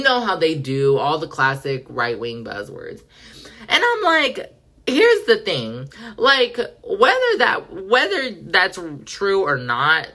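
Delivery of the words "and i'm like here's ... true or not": high, slow put-on voice; emphatic high voice. Explaining to haters why Ken is dumb, high and slow. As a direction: lift it up high and stretch it out. Putting on a voice to make point.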